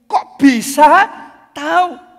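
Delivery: high, loud, slow disbelief; amazed question. Acted. Juan amazed the guard found him. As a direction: ask in open wonder. Preacher voices Juan's amazement.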